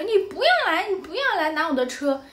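put-on high, nasty child's voice telling him off; a spiteful girl guarding her car; imitating a fierce little girl. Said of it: "表情就很厌恶、很凶". (模仿小女孩凶巴巴地尖声喊)

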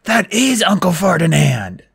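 Grover gasping. A narrator's reading. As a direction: gasp it out in alarm. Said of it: "Grover gasped".

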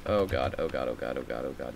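low, soft panicked repeated prayer; terrified muttering. Real, not acted. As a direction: chant it under your breath in dread. Spider appears, panicking.

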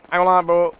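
high, loud excited outburst. Real, not acted.

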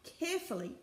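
rhythmic refrain, building suspense; story narration. A narrator's reading. Reader follows the creeping crew to a blockage, story narration. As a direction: read it phrase by phrase with emphasis.

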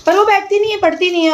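mock exasperation at the daughter who won't sit; exasperated complaint. Real, not acted.

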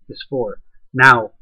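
usual speaking voice

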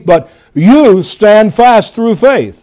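YOU held long and set apart, slow line; slowly, leaning hard on "you"; stress on YOU. Shown as a demonstration. Preacher reading verses to show individuals, stress on YOU. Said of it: "I'm going to emphasize the word you".

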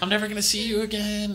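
high, hammed-up distraught girlfriend; mock crying. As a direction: wail it in a high put-on girl's voice. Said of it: "she's, like, crying".

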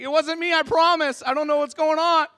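high, frantic mock protest of innocence; sounding guilty. Suspect pleading with police, frantic guilty-sounding protest. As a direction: protest like a cornered suspect, hammed-up. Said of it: "I sounded like a criminal already".

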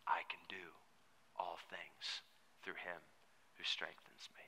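soft, secret whisper leaning in close; whispered verse. Retold or imitated. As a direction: whisper it like an old man sharing a secret. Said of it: "he just whispers verse thirteen to me".

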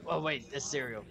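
normal talking voice

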